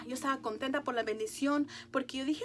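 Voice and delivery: usual speaking voice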